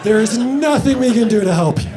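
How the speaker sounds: hammed-up doctor doom; loud, grave doctor warning; dramatic warning.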